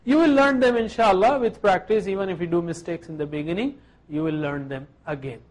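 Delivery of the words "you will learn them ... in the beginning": ordinary delivery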